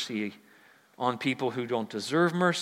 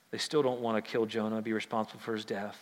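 ordinary talk, nothing marked

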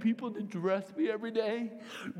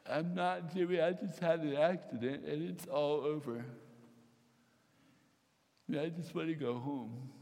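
tearful, defeated; 4 semitones lower than usual. Retold or imitated. Crying after the accident.

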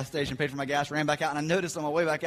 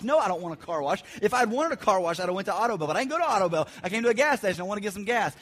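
exasperated rant; 5 semitones higher, 6 dB louder than usual. Retold or imitated. Ranting at the gas pump.

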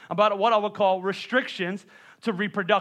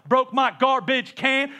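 slow, long-drawn syllables, a bit louder; stretched vowels; 5 semitones higher, 4 dB louder, slower than usual. Shown as a demonstration. Preacher demoing the stretched complaint, slow and drawn out. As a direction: draw out "Broke my garbage can" with long vowels. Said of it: "your syllable super long".

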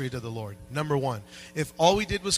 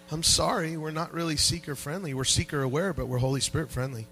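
ordinary talk

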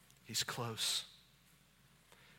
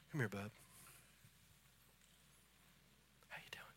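much softer, lower private words; quiet personal aside; 4 semitones lower, 8 dB quieter, faster than usual. Real, not acted. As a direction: speak gently to one person up front.

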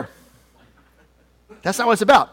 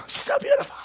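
very high, teary gushing voice; hammed-up falsetto sob of admiration; mock weepy wedding guest; 3 dB quieter, faster than usual. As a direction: gush like a guest crying at the aisle. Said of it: "everybody's just like".

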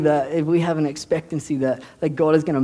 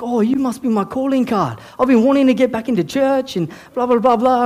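higher-pitched, gushing imitation; eager retold reply; 6 semitones higher than usual. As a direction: gush it out up high, like a delighted new acquaintance. Retold excited reply of the Islander.

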